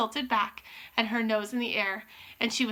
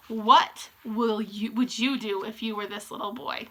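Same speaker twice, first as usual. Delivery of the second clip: ordinary delivery, nothing marked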